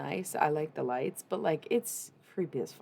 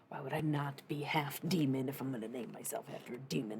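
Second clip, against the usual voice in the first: hushed aside. Real, not acted. Jokey aside about her D&D character.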